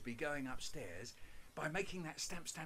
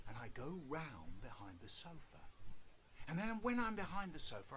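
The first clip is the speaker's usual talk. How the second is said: ordinary talk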